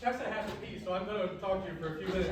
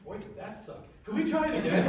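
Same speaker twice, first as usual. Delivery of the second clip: ordinary talk, nothing marked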